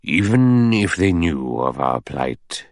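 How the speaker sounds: very slow, deliberate judicial pronouncement; thoughtful old judge. Acted. Judge doubting a boat will come, slow and deliberate.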